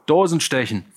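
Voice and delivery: high and loud bellowing; bellowed order. Acted. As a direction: roar the drinking order. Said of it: "brüllte er".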